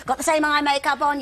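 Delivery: high, loud mock scolding; strict dresser yelling. Wardrobe mistress nagging the child actress, loud and high. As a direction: bark at the young girl like a fussy dresser. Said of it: "scream and shout".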